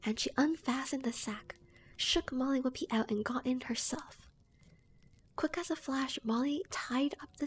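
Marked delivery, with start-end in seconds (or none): none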